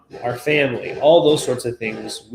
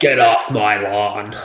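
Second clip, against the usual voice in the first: put-on slow, lower cranky old-man grumble; grumpy old man; 3 semitones lower, slower than usual. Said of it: "your best old man voice".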